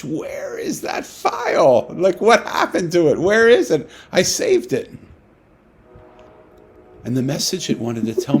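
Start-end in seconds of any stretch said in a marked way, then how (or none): 0.0-4.9 much higher, slow frantic cry over the lost file; panicked author shouting for his lost book; anguished cry. Retold or imitated. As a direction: wail it like waking in panic. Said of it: "screaming in anguish".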